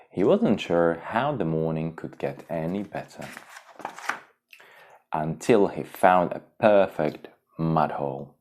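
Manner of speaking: plain reading voice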